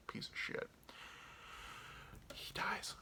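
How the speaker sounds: hushed whisper; secretive whisper; whispered spoiler. Real, not acted. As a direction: whisper it so nobody hears the spoiler. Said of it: "spoiler said under breath".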